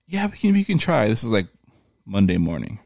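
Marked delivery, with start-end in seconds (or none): 0.1-1.1 high, breezy lady agent voice; mock phone agent. Mocking the FedEx lady to his cohost in a high voice. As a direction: pitch way up and play her careless.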